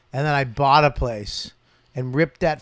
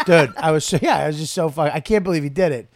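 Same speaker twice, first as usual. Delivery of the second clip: normal talking voice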